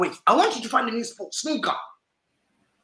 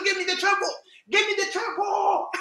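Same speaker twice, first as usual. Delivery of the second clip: demanding; 9 semitones higher than usual. Retold or imitated. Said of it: "I'm telling Pep Guardiola".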